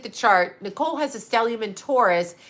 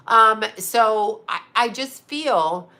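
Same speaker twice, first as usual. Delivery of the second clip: ordinary talk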